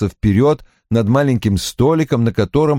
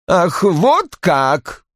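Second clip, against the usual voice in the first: slow, higher-pitched spite; spiteful hiss; 8 semitones higher, much slower than usual. A narrator's reading. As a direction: draw it out with malice. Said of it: "злобно прошипел Рой". (злобно, протяжно)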